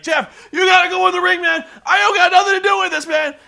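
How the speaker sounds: high, loud hammed-up Ric Flair shout; mock Ric Flair yell. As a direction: bellow it like a frantic Ric Flair. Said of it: "He's like".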